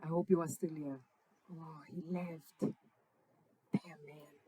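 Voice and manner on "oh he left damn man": whispering. Real, not acted. Disappointed aside when viewer leaves.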